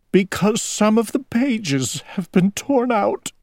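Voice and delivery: high, weepy owl voice; plaintive, as tears keep falling; crying. A narrator's reading. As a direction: lament it in a high tearful voice. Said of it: "Owl was crying".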